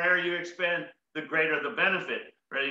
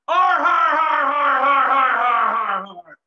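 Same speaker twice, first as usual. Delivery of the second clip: loud, high pirate har har; pirate laughter; 6 semitones higher, 9 dB louder, slower than usual. Shown as a demonstration. Showing the audience his best pirate laugh. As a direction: roar it like a pirate captain. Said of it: "give me your best pirate laughter".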